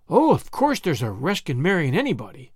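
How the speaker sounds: plain reading voice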